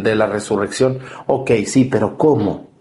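usual speaking voice

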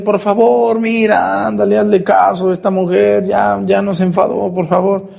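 impatient pleading. Acted. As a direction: nag him to just help her. Apostles pleading with Jesus.

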